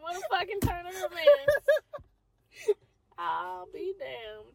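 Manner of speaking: ordinary delivery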